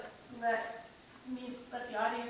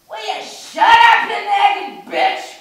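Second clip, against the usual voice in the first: slow, high full-volume yell; angry shout; 10 semitones higher, 21 dB louder, much slower than usual. Acted. Imagined stranger telling the nagging shopper off, furious shout. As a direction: explode at them, stretching the words out.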